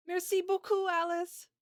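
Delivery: put-on high, loud, thrilled girl's thanks; excited. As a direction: burst out the thanks in delight. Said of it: "with excitement in her voice".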